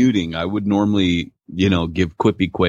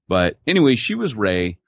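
ordinary talk, nothing marked

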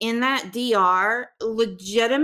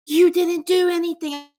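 higher and louder, pleading at the screen; yelling at the TV; 6 semitones higher, 5 dB louder than usual. Retold or imitated. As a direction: shout it at the TV as if she could hear. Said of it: "screaming at my T V".

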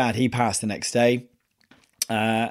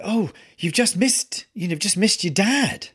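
raised pitch, imitating a nurse's careless chirp; lightly voiced nurse letting it slip; nurse blurting the news; 8 semitones higher than usual. Nurse voiced in retold story.

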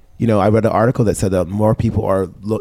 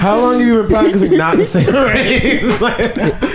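high, laughing through the words; 14 semitones higher than usual. Real, not acted. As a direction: giggle while teasing. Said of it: "laughing at the accent".

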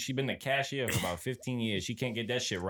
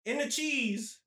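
much higher, incredulous disbelief; 13 semitones higher, much slower than usual. Real, not acted. Boss tells of employee's finger in food, incredulous. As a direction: stress it in high-pitched disbelief. Appalled retelling of employee's blunder.

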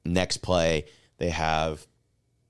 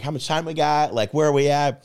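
excited yelling; 10 semitones higher, 7 dB louder than usual. Retold or imitated. Yelling in car at game.

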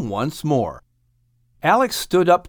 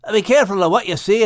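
fast, cutting in; quick warning; quick interruption; 6 semitones higher, 3 dB louder, faster than usual. A narrator's reading. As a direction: cut in quickly as Max. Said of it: "interrupted Max".

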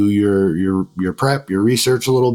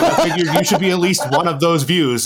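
real laughter through the tease; host teasing a guest, laughing mid-line; 8 semitones higher than usual. Real, not acted. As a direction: barely hold it together while teasing him. Teasing Max about his video.

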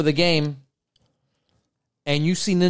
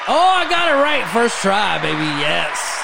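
tipsy, high and loud cheer; 4 semitones higher, 4 dB louder than usual. Real, not acted. Tipsy streamer finds applause button.

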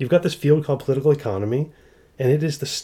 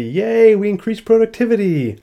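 high, loud sarcastic celebration; hammed-up cheer of triumph; mock cheering; 8 semitones higher, 5 dB louder than usual. Economists celebrating increased productivity, mock cheering.

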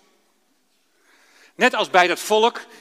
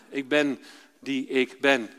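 slow, calm repetition of the name; 6 semitones lower, 5 dB quieter, slower than usual. Retold or imitated. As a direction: let the name sink in slowly. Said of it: "heel rustig gelezen". (rustig herhaald)

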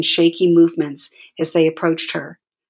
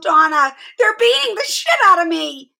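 yelled; 13 semitones higher, 5 dB louder than usual. A narrator's reading. Handcuffed mother yelling about her hands, very high yell. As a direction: howl it as the cuffs bite. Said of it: "she yelled".

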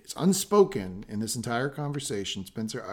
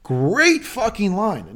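excited rave; 10 semitones higher, 8 dB louder, much slower than usual. Real, not acted. Raving about a quoted line.

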